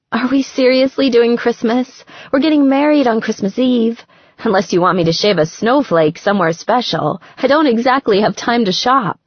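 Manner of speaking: playful disbelief at a Christmas gift question; incredulous Gretchen. Acted. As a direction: tease him, quick and disbelieving.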